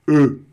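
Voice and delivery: E with O-rounded lips, projected. Shown as a demonstration. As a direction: hold the O, say E. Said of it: "tenta falar ao mesmo tempo o E".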